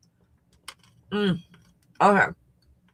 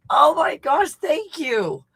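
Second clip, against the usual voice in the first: high, emphatic, relieved thanks; amazed gratitude; 7 semitones higher, 4 dB louder, slower than usual. Real, not acted. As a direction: gush with thanks for an unexpected rescue.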